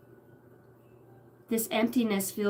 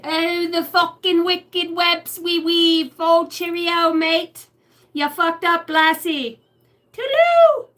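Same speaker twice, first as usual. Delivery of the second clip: high, loud, slow hammed-up British accent; over-the-top fake British accent; mock British accent; 7 semitones higher, 8 dB louder, slower than usual. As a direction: ham up a cheeky British bloke.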